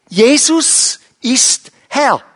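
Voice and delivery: normal talking voice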